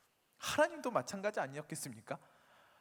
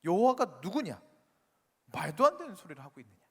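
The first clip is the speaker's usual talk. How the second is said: loud, indignant challenge; acted royal scorn, loud then quickening; scoffing Pharaoh; 8 dB louder than usual. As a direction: throw back the demand with loud disdain. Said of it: "그 콧방귀를 꼈을 것입니다".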